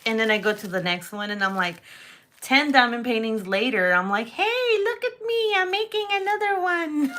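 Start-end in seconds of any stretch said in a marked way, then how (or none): 4.3-7.1 very high-pitched mock bragging; silly voice. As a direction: sing out for attention in a silly squeaky voice. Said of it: "talking about silly sounds and voices".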